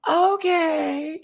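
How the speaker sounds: high, stretched-out "okay"; re-enacted first reaction. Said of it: "Originally, this is me".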